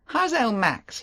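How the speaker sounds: teasing disbelief; Favell. Acted. Favell voiced.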